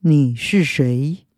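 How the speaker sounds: low, slow voice from the dark; slow and low, hidden in the dark. Acted. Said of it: "从黑暗中传出一阵低沉的声音".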